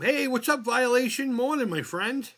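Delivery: loud, high cheerful hello; excited greeting. Real, not acted. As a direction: greet a friend happily. Greeting a new caller.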